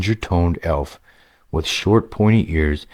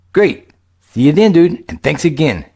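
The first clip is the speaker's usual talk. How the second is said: put-on lightly raised, eager young gamer voice; a casual young player, a bit higher than the narration; upbeat young adventurer, higher pitched; young hero Greylick; 11 semitones higher, 5 dB louder than usual.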